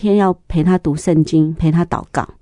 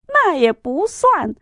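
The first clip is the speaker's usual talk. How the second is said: amused correction; 11 semitones higher, slower than usual. Retold or imitated. Mom correcting son, amused.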